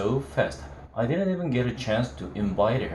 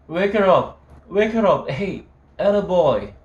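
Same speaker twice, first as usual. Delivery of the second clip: much higher, louder, hollering; drunken shout; 8 semitones higher, 7 dB louder than usual. Acted. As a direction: yell it drunkenly down the phone. Drunk yelling down the phone.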